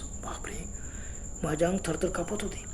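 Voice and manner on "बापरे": barely breathed exclamation of fear; whispered gasp. Retold or imitated. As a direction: breathe it out in terror. Terrified in the silent forest.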